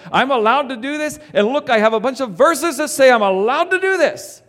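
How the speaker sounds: loud, high, smug insistence; on a high horse. Retold or imitated. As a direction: boast about being allowed. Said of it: "on our high horse saying".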